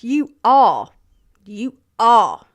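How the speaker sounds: "are" hit loud both times in "You are, you are"; capitalised emphasis. Acted. Streamer reading out a donor's message, loud emphasis on "are". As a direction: punch each "are" loudly, like words in capitals.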